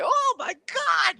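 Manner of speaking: high-pitched mock gasp of alarm; drawn-out, squeaky exclamation of shock; shocked onlooker. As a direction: play a startled crowd member. Crowd reacts to drawn blades.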